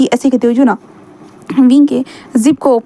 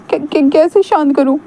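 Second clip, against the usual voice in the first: put-on higher, slow stammering boyish voice; nervous Wing; 4 semitones higher, slower than usual. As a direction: stammer it out, small and timid. Wing's nervous voice.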